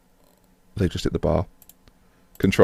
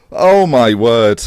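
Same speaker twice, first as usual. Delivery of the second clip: usual speaking voice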